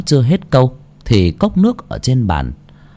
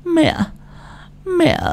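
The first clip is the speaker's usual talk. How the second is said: high, soft pleading; high faint plea; pleading stammer; 5 dB quieter than usual. A narrator's reading. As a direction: beg the son for help. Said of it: "cầu cứu giọng lắp bắp".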